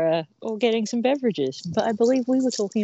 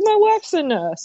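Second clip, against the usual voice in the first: smug excuse voice; 8 semitones higher than usual. Retold or imitated. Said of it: "He's like".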